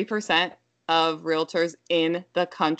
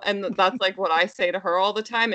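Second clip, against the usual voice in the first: ordinary talk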